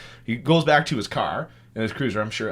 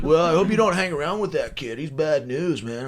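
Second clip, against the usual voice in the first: lightly imitated cop, raised pitch; cop role; 7 semitones higher than usual. Cop warning driver off that kid, mock cop voice. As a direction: warn like a chummy officer. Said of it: "he's like".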